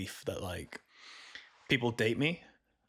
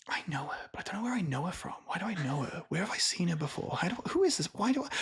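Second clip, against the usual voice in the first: higher, increasingly baffled self-questioning; acted-out puzzlement; 7 semitones higher, faster than usual. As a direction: replay rising confusion in rapid questions. Acting out puzzled recognition.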